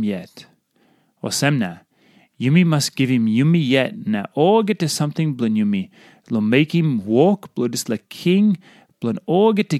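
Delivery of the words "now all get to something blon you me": ordinary delivery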